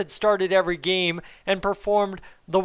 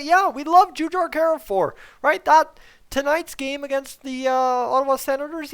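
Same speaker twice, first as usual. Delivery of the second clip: ordinary talk, nothing marked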